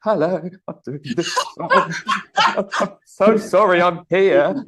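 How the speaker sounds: high, slow, drawn-out mock stage greeting with a laugh; hammed-up nervous opener. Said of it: "so I just went into the".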